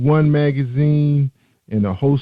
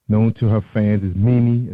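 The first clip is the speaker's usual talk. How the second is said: ordinary talk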